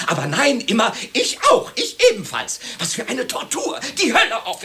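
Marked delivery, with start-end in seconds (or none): 1.1-2.6 high, mocking imitation of the agreeable spouse; sing-song mock agreement; mocking parrot. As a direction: parrot the partner's eternal yes in a shrill mock. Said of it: "Sag wenigstens einmal".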